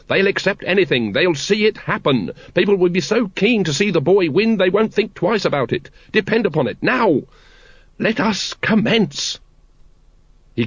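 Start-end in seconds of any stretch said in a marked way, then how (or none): 0.1-9.4 insistent, raised-pitch retort; snappish. A narrator's reading. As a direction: press the point, higher and impatient. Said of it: "snapped the Supreme Grand Master".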